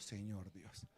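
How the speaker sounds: muy bajo y grave; oración íntima. Shown as a demonstration. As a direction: invoca a Dios casi para ti. (invocación suave y grave)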